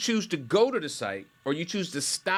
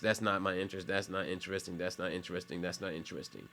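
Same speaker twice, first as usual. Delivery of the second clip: softer and softer, uninterested; bored dismissal; 6 semitones lower, 9 dB quieter, faster than usual. Retold or imitated. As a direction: brush each video off as it fades. Scroller dismissing TikTok videos.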